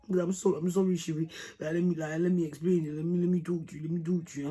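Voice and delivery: mock pleading in a put-on voice; whiny-boyfriend impression, pleading to explain; begging boyfriend. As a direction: imitate him begging with insistent repeats. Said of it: "was crying and begging me".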